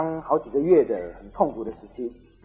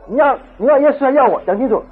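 raised, loud acted demand; demanding husband; 9 semitones higher, 8 dB louder than usual. Husband pressing his wife to choose, loud and demanding. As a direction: press hard for a straight answer.

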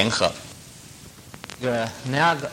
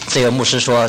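ordinary talk, nothing marked

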